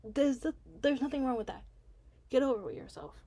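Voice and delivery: irritated telling-off. Real, not acted. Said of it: "that irritated me".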